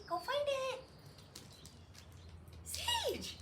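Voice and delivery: high, happy go find it and name; high excited send-off to the puppy; excited cue. Shown as a demonstration. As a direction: lift it high to send her. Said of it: "so you can see that with her".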